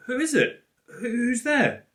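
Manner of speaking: put-on high, loud, stammering child calling out; nervous call. Hidden girl calls to the stranger, high nervous stammer. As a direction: ask it scared, repeating yourself.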